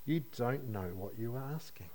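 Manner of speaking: soft, resigned sigh of a reply. Retold or imitated. Jesus answering the ambitious brothers, softly resigned. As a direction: let it drop, gently resigned. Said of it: "almost a resigned".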